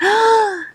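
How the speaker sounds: a sharp, high excited gasp. Retold or imitated. Sylvia hearing dinner offered, sharp excited gasp. She got excited, gasping.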